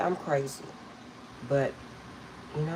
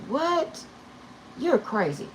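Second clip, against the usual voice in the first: high incredulous what; mock disbelief; 9 semitones higher, slower than usual. Mother brushing off her daughter's idea with a high, incredulous scoff. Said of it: "And I was like".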